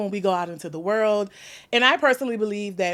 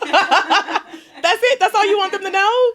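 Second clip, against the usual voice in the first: high laughing disbelief, louder; laughing tease; 10 semitones higher, 7 dB louder, slower than usual. Real, not acted. Host teasing cousin's short intro, laughing high and loud. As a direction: tease her through laughter.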